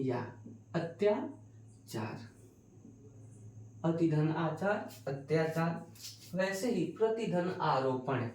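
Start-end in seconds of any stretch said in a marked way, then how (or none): 0.7-2.3 अत्याचार drawn out slowly, each syllable clear; teacher slowly pronouncing अत्याचार as the answer; slow clear model. Shown as a demonstration. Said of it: "teacher modelling the joined word". 3.8-6.4 slow recap. Shown as a demonstration. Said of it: "teacher repeating the sandhi slowly".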